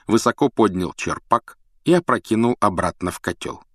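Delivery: ordinary delivery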